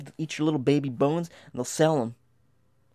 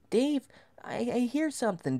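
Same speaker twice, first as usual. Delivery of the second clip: high, hushed fear; high scared voice; 10 semitones higher, 4 dB quieter than usual. Retold or imitated. Boy telling Dave about the noise, high scared voice. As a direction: whimper it up high and small. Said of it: "I say".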